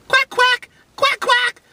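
high, loud mock duck quacking; duck quacks. Vlogger quacking like a duck at his food. Imitating duck for crazy chicken.

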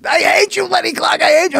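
high mock screech of an old lady; angry old lady. Old lady neighbor yelling she hates Lenny, high mock screech. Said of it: "she goes".

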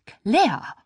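high, scandalised cry of the name; reproachful, shocked. A narrator's reading. Mom turns on older daughter, shocked and reproachful. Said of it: "Ihre Stimme klang vorwurfsvoll und schockiert".

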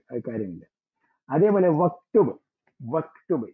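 usual speaking voice

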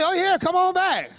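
hammed-up parents pretending to be thrilled; overeager parents. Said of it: "They're like".

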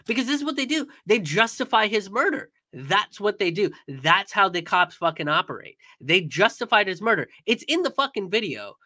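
worked-up, exasperated protest; outraged rant. Real, not acted. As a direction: drive each accusation home in real outrage. Outraged rant about justified killing.

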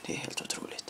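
hushed amazement; whisper. Real, not acted. Whispers amid play.

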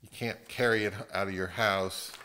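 ordinary delivery

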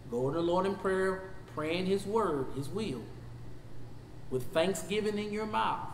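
ordinary talk, nothing marked

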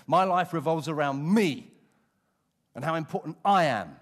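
defiant pride. Acted. Voicing proud defiant Saul.

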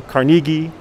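kar-NEE-ghee, held up as one choice; offered pronunciation option. Shown as a demonstration. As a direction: say Carnegie with a long ee in the middle. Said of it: "you choose how you wanna".

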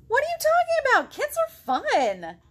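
very high, loud protest; outraged kid defending fun; indignant kid. Acted. Girl in the book protesting to the potato, very high and loud. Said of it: "girl protests".

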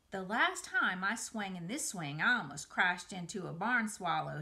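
usual speaking voice

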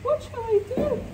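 high, rising plea; fearful whimper. Real, not acted. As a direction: pitch it up in panic. Panicking about the dark.

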